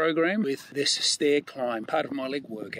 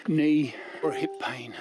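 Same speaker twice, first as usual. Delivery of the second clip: ordinary talk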